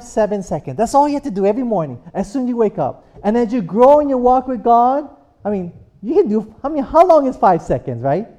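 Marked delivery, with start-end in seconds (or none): none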